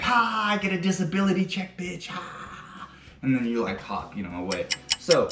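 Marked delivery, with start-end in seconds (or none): 0.0-2.9 higher-pitched hammed-up taunt, trailing into breath; play-acted triumphant jeer; mock gloating. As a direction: crow it back at the mockers. Acts out a gloating comeback.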